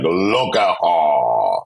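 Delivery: slow, drawn-out sassy queen voice; sassy drag queen. Retold or imitated. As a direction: stretch it out with shade. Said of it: "I sort of took on that voice".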